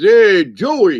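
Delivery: put-on Brooklyn mobster accent. Mobster in his play greeting Joey in Brooklyn accent. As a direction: call out like a New York mob guy. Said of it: "I was talking like this, you know, like a mafioso".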